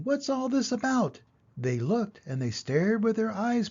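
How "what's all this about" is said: high excited crowd call; a crowd calling out in wonder; shouting. A narrator's reading. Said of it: "the people came shouting".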